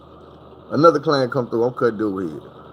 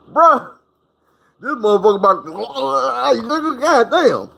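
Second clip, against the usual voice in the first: high, flustered stammer; flustered plea; 9 semitones higher than usual. Retold or imitated. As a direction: caught mid-act, stammering high to the barber. Flustered talk to the barber.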